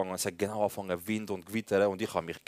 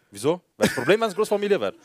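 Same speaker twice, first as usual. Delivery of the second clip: high, loud joking confrontation; mock challenge; 7 semitones higher, 5 dB louder than usual. Cousin kidding the neighbour about family, mock-offended challenge. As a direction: play-act taking offence, pitch jumping up. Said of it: "er so als Spaß".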